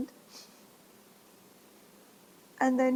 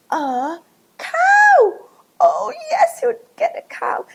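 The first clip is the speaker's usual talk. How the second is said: much higher and louder, gasping with joy; thrilled cry; 9 dB louder than usual. Acted. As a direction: shout it out in delight. Gasping joy at getting a cow.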